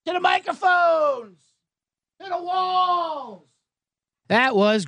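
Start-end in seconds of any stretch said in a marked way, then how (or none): 0.0-3.4 high, slow drawn-out call; mock yell. Shown as a demonstration. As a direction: call it out to the whole room, stretched. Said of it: "I'm gonna yell".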